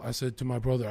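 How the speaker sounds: normal talking voice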